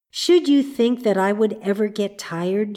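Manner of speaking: plain reading voice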